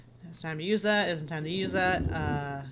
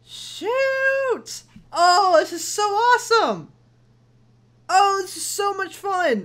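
excited squealing; 11 semitones higher, 5 dB louder, slower than usual. Real, not acted. Unlocked author's notes, delighted.

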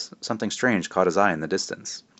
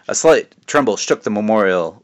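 usual speaking voice

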